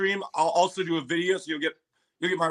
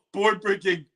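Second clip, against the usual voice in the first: ordinary talk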